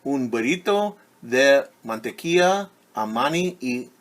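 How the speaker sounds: lively picture-book read-aloud; reading to children. A narrator's reading. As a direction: read it brightly to a class of kids.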